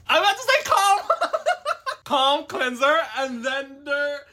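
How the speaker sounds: giggling through the slip; laughing. Real, not acted. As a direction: laugh while correcting yourself. Laughing at her own slip.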